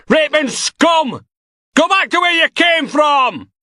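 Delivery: high, slow, furious shouting; an enraged protester yelling at the prisoner; furious insults. Acted. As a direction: scream abuse at him.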